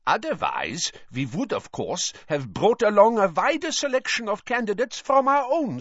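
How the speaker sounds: high, smooth foreign headmaster; sly and cold, smiling politely; a suave villain's complaint; Karkaroff's steely voice. A narrator's reading. Karkaroff continues.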